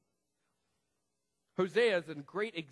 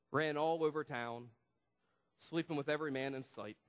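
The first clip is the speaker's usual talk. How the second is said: ordinary talk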